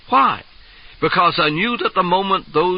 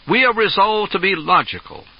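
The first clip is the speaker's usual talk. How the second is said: stage line as Caligula; 6 semitones higher than usual. Acted. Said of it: "That was one of my lines".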